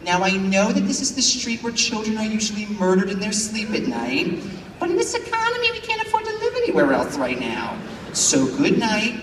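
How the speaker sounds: bedtime reassurance gone absurd; mock-soothing parent voice; parent tucking kids in. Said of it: "they're like".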